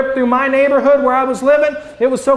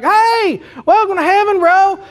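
bro Saint Peter; 2 semitones higher than usual. Retold or imitated. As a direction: greet them like a cheerful bro at the gates. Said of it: "Saint Peter's like".

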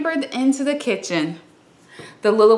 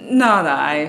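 lightly voiced lazy dog; 6 semitones lower, 3 dB louder than usual. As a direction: lower gruff-ish dog. Dog refuses.

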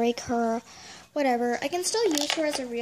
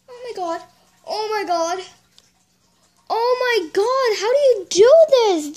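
much higher and louder, thrilled; overwhelmed excitement; 7 semitones higher, 10 dB louder than usual. Real, not acted. Thanking the trader for a perfect doll, loud thrilled gush.

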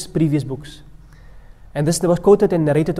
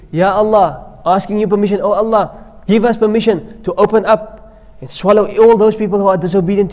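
earth pleading with God; 4 semitones higher, 6 dB louder than usual. Acted. Earth pleads with Allah, voiced.

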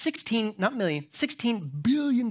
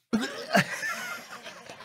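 high wheezing laugh; 5 dB quieter than usual. Real, not acted. Preacher laughing at his own prank story. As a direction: burst into a squealing laugh. Retells Carly's reaction laughing.

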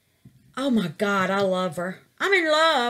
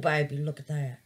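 normal talking voice

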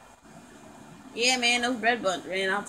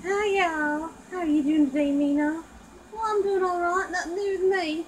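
put-on higher, bright friendly girl chatter; chatty girl doll voice; 6 semitones higher than usual. Girl doll greeting her friends, higher and chatty. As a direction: greet the girls like a cheerful friend.

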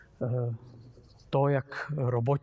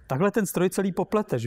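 higher-pitched alarmed exclamation; crying out; 6 semitones higher, 4 dB louder than usual. A narrator's reading. Trurl protesting that Klapacius confuses the machine, crying out. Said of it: "vykřikl Trurl".

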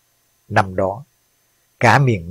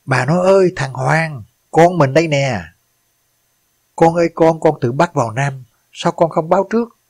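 kêu gào thảm thiết, giọng vút cao; gào khóc gọi con, giọng cao và vỡ ra; gào lên; 6 semitones higher than usual. Acted. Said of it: "Ông gào lên". (người cha gào lên khi nhận ra xác con trai mình)

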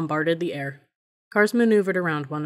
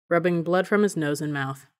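plain reading voice

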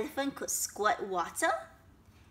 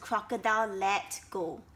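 usual speaking voice

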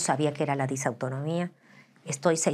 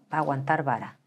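ordinary talk

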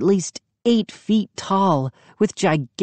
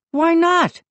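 demanding; 14 semitones higher than usual. A narrator's reading. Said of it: "he demanded".

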